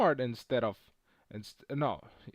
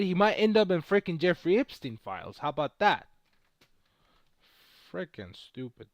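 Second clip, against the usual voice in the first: ordinary talk, nothing marked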